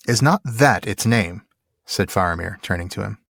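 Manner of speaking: measured dramatic reading, firm on the quotes; story narration. A narrator's reading. Co-host reading Faramir's judgment on Gollum, formal story narration. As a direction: read it as a solemn literary passage. Reads the novel aloud.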